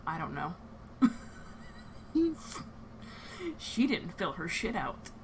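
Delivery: calm, unhurried storyteller narration; game master scene setting. Acted. Game master setting the scene on the train, calm narration. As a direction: set the scene for the players.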